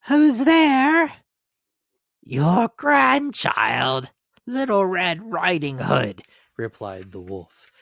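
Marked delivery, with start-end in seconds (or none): 0.1-1.1 put-on much higher, louder old woman; grandmother's voice. A narrator's reading. Grandmother in bed calling to the door, high. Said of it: "grandmother answers the door". 2.2-6.2 slow, higher put-on girl voice; wolf pretending to be the sweet girl; wolf faking girl's voice. A narrator's reading. Wolf at grandmother's door faking the girl's voice. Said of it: "counterfeiting her voice".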